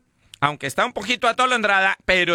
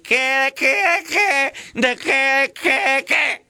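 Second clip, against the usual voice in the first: high, higher mock echo; mocking drunk; 7 semitones higher, much slower than usual. Drunk echoing his wife's questions back in a high mock voice. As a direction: parrot her back mockingly.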